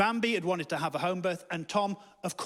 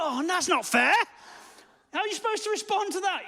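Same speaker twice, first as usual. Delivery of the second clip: high, whining complaint; indignant protest; 12 semitones higher, 3 dB louder than usual. Acted. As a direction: protest as if unfairly cornered. Cornered husband protesting to himself.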